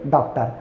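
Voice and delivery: डॉक्टर said quietly; correct pronunciation. Shown as a demonstration. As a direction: answer डॉक्टर with the in-between ऑ. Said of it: "क्या बोलेंगे?".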